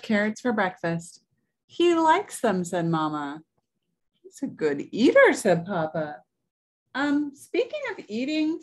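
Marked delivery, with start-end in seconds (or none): 1.7-2.6 high, louder, pleased exclamation; delighted mother. A narrator's reading. Mama watches Wolfie at breakfast, delighted. 4.2-5.3 high, loud proud exclamation; proud papa. A narrator's reading. Papa praises Wolfie at breakfast, proud and delighted. 6.9-8.6 high-pitched, worried little bunny; worried interjection. A narrator's reading. Dot interrupts her parents about the wolf, worried.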